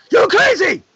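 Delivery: a stuntman's shouted joke line; mock yelled line. Green Ranger shouting his joke line at the director, loud and high. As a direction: yell it high and loud, hammed up. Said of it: "the Green Ranger goes".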